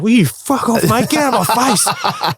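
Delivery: mock angry retort. Player telling the taunting opponent to back off, mock fury. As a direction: bite back like you're riled up.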